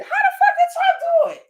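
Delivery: can't believe the nerve, laughing; incredulous laughing. Real, not acted. Ranting at a politician's excuse, laughing in disbelief.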